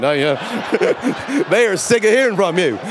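loud, high, laughing through the words; laughing hard. Real, not acted. Preacher to the congregation, laughing loudly at his own joke. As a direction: crack up while saying it.

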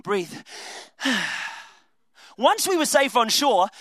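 ordinary talk

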